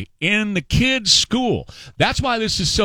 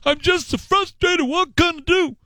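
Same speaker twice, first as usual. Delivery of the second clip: much higher whiny mock of "What can I do?"; imitating frustrated listener; 10 semitones higher than usual. Host mimics his listeners' helpless frustration. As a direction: play a helpless, frustrated caller. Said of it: "you say".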